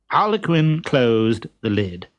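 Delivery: ordinary delivery, nothing marked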